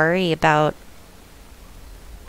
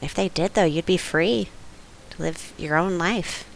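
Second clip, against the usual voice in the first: put-on southern accent; 9 dB quieter than usual. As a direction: coax him in a southern accent. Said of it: "I did the southern accent".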